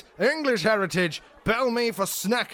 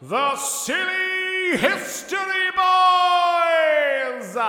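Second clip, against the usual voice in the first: drawn-out shout; 11 semitones higher, 6 dB louder, much slower than usual. Acted. Group title shout.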